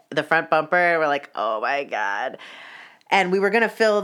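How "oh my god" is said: slow, low groan of disbelief; weary retold horror; appalled dismay. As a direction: let it sink, drawn out and heavy. Dismayed reaction to curbing the car.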